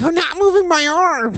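put-on very high, whiny childish voice; squeaky kid insisting he's innocent; whiny child denial. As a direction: protest like a sulky little kid.

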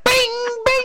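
ringing bing bing, jumping way up in pitch; ping it out like a bell; high-pitched "bing" sound. Real, not acted. Cheering a team's uniforms.

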